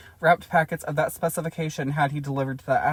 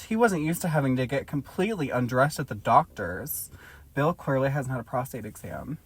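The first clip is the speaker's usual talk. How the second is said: ordinary talk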